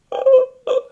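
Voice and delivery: high loud gasp of alarm; shocked gasp. Retold or imitated. Baristas panicking over wild dogs, loud gasp. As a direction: gasp as if horrified.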